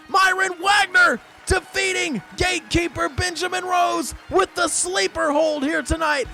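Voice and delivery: very high, loud shouted commentary; frenzied finish call. Real, not acted. Commentator calling a sleeper-hold victory, frenzied shouting. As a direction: shout the winning hold at the top of your voice.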